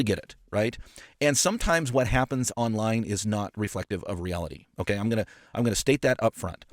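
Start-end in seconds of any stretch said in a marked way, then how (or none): none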